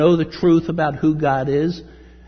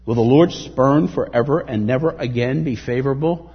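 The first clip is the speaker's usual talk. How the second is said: louder, questioning verse; preaching scripture; 5 dB louder than usual. Acted. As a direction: ask the verse out to the hall. Reads psalm with force.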